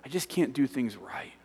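soft, defeated admission; small, crushed apology; hurt, self-blaming response. Retold or imitated. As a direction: sigh and beat yourself up. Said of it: "response of people that have been hurt by church".

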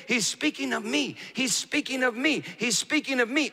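high, impassioned repetition; imitated inner voice, insistent and elevated; insistent retold voice. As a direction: press the phrase with rising urgency, pitched high. Monk retells an urgent inner voice.

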